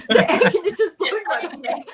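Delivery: fast, high, laughing. Real, not acted. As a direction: laugh it off quickly. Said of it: "I'm gonna sneeze".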